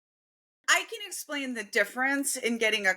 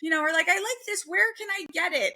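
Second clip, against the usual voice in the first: much higher cooing imitation; 10 semitones higher than usual. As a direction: gush like a covetous shopper. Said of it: "it's always like".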